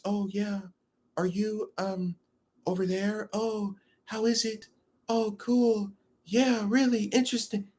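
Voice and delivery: put-on high, soft, bored girl voice; monotone girl voice. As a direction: mimic a bored girl droning. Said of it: "in their little monotone voice".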